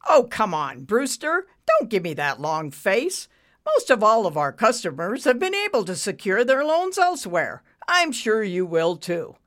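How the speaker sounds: loud, breezy brush-off; jolly him along dismissively; arrogant banker, breezy. A narrator's reading. Banker brushing off stricken borrower, breezy. Ricky's character voice.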